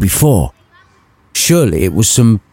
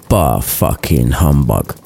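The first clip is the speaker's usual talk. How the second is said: put-on low, slow old miser grumble; grumpy Scrooge; 8 semitones lower, slower than usual. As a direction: drag it out low like a sour old man.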